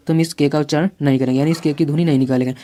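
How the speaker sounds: ordinary talk, nothing marked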